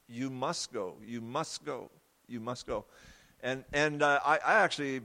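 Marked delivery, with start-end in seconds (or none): 0.1-2.8 much softer, calm and tender repeated urging; gentle inner voice. Retold or imitated. Preacher voicing the gentle voice urging him to church, soft and tender. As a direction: coax him softly, like a kind whisper of hope. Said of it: "It was gentle".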